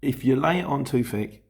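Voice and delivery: ordinary talk, nothing marked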